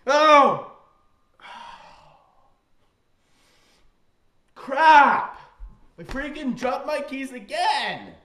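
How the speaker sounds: very loud, high staged outburst; mock-panicked shout. Prankster faking a second key drop to boy, panicked holler. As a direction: holler it as a fake disaster. Fakes dropping the keys again.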